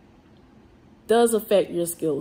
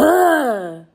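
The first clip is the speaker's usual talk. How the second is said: loud exasperated groan; real annoyed groan; disgusted ugh; 6 semitones higher, 9 dB louder than usual. Real, not acted. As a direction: let out a fed-up ugh. Venting annoyance at rude people.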